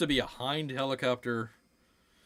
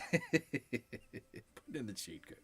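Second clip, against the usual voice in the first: slow, soft, chuckling repeat; laughing; 10 dB quieter, much slower than usual. Real, not acted. Repeating his own joke through a soft laugh. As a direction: laugh it out quietly.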